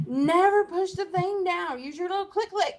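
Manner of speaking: high-pitched retold parental lecture; mock exasperation. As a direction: lay down the rule, retelling a scolding. Retells scolding her daughter.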